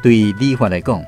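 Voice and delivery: normal talking voice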